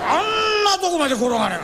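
high, loud yell at the stubborn die; exasperated shout. Acted. Gambler yelling in frustration at the die that won't roll. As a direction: throw your arms up and bellow it.

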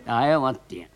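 ordinary talk, nothing marked

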